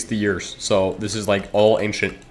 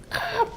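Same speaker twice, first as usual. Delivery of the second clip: much higher, softer mock shriek of the neighbor; imitated high scream; 8 dB quieter than usual. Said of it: "His voice is too high pitched".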